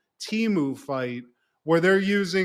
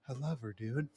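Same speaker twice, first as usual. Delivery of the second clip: much softer, lower, heartfelt; tender confession; 5 semitones lower, 15 dB quieter than usual. Retold or imitated. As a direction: confess it quietly like a wounded bro. Kyle's tender confession to Jack.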